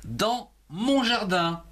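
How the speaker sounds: Dans mon jardin read slowly word by word; slow dictation. Shown as a demonstration. Teacher dictating the title slowly to pupils. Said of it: "Commençons par le titre".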